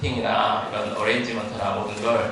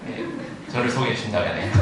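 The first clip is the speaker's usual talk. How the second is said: normal talking voice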